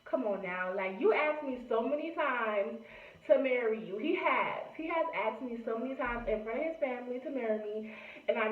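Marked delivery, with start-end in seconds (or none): none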